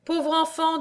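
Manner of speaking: high, thin-spirited mother's voice; faint voice. A narrator's reading. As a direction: speak as a mother worn out by emotion. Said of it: "disait la mère d'une voix éteinte". (voix éteinte)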